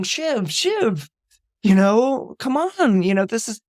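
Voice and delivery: high, urgent pleading brother. Retold or imitated. Brother begs sister before the vote, pleading. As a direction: beg her to come around. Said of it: "Kendall is pleading with her".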